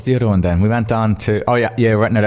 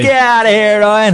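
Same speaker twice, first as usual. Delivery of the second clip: much higher, louder mock shout; playful shout; 13 semitones higher, 6 dB louder than usual. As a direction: shoo him off in a big joking yell. Shooing off intruding Ryan.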